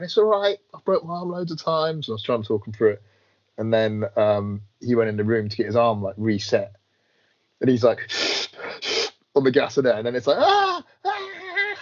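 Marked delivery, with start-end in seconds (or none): 0.0-2.1 mock breezy reassurance, much higher; half-hearted cheerful comfort; reassuring friend. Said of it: "I was going". 10.4-11.8 high, imitated cries of pain; mock high-pitched pained cries; imitated scream.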